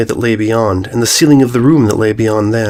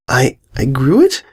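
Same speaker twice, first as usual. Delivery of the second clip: stammering filly; 3 semitones higher than usual. Acted. Sweetie shocked.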